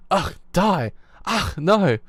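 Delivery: loud, high grunting cries; hammed-up struggle cries. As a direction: fake a desperate fight, loud. Acting out the game's violent scene.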